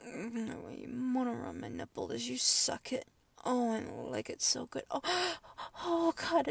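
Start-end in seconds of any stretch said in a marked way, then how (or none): 5.0-5.8 gasp. Acted. Sharp intake of breath.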